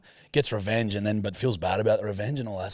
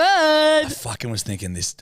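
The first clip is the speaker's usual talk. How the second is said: a high, loud burst of vindicated excitement; excited outburst; 8 dB louder, slower than usual. Real, not acted. Mate gloating to her cohost that she guessed right, excited. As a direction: crow that you knew it all along.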